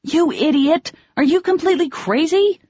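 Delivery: yelling from the cliff at the reckless man; angry shout. Acted. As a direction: shout down at him, fists clenched. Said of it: "shouted down at him".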